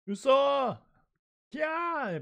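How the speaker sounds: high, loud cries while falling; alarmed scream. Acted. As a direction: scream the name as you drop. Nami screaming as they fall.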